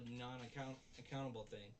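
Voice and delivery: ordinary talk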